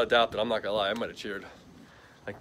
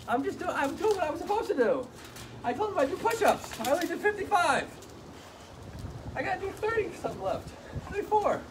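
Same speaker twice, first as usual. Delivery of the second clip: high squeaky pretend dog voice; voicing the dog. Owner speaking for the busy dog in a high squeaky voice. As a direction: put words in the dog's mouth, high and silly.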